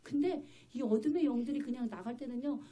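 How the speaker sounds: ordinary talk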